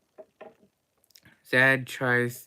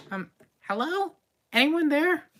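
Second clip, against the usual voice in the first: unsure call; 4 dB louder than usual. Acted. Another high call into the room.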